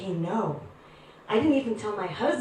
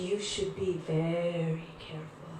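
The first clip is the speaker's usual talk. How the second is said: low, soft warning; 5 semitones lower, 7 dB quieter than usual. Retold or imitated. Guru's grave warning.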